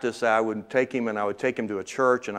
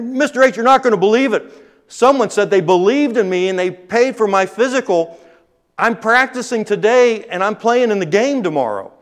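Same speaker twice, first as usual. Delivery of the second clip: put-on excited kid; 10 semitones higher, 7 dB louder than usual. Adam bursting in with news.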